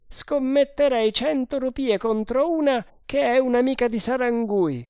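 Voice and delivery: high, loud voice barking hurried orders; Bharata, urgent. A narrator's reading.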